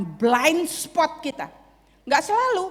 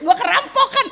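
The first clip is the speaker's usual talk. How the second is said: high, loud hammed-up laughter; mock laughing; 11 semitones higher, 6 dB louder than usual. Robbery victim absurdly laughing about it, mock laughing. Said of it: "terus ketawa-ketawa".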